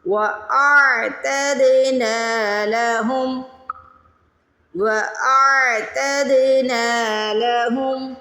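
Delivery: slow, melodic tajweed recitation; Quran recitation. Shown as a demonstration. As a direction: chant each phrase slowly, repeating for students. Full recitation, repeated for memorizing. (آہستہ، ترتیل کے ساتھ قرآن کی تلاوت)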